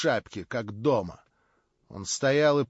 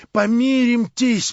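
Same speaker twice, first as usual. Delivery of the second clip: high, loud and slow begging cry; desperate plea; 9 semitones higher, 7 dB louder, slower than usual. A narrator's reading. Verkhovensky begging Stavrogin loudly to make peace. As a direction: beg him loudly, dragging it out. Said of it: "прошептал он ему судорожным шёпотом".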